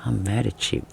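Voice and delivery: soft, pouty mock anger; a quiet, half-hearted grudge; mock pout. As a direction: mumble it like a sulking kid. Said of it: "you're standing there like".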